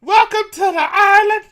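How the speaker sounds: very high, loud cartoon toy; squeaky toy voice. Toy character greets them.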